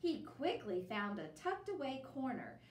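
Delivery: usual speaking voice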